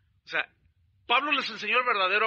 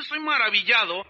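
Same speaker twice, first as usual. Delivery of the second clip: usual speaking voice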